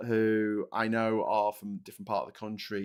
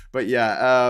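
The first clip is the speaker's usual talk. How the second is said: normal talking voice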